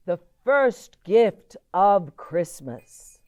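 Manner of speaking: loud, slow proclamation; calling out. Acted. Santa proclaiming loudly to the cheering elves. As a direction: announce it to the crowd of elves. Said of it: "and called out".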